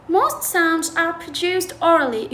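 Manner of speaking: slow, careful read of these are called affricates; reading the textbook aloud. Shown as a demonstration. Teacher reading the textbook to students, slow and careful. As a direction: read the English line slowly for the class. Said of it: "يقول".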